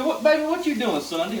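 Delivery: Mama T voice. Retold or imitated. Mama T sweetly asks about his Sunday plans, high-pitched. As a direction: sweeten it up like she wants a favor. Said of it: "She goes".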